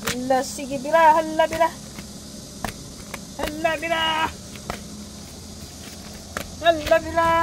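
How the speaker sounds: high, eager coaxing call to pets. Real, not acted. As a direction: cheer the pets on brightly. Urging pets to eat.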